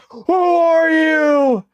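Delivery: slow, high, shouted movie-villain line; Batman villain impression. Streamer quoting Tim Burton's Batman villain to opponent, slow shouted. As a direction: drag it out like a menacing film villain. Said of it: "It's like the guy from Tim Burton's Batman".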